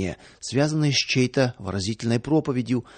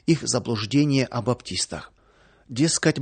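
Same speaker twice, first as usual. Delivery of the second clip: usual speaking voice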